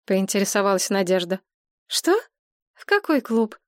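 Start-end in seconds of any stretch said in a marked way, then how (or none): 1.9-3.6 high startled question; surprised. Acted. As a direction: caught off guard, puzzled. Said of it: "удивлённо переспросила Машка". (удивлённо переспрашивая)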